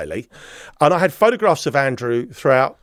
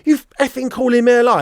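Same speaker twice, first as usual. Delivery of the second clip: mock angry accusation, pitched up; retold growing anger; 9 semitones higher, 3 dB louder than usual. Sergeant accusing suspect in interview room, retold anger. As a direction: imitate someone getting angrier, without shouting. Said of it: "he started getting angrier and angrier".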